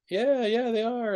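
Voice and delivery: put-on high old lady voice. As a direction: pitch it up like an old woman. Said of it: "the old lady's like".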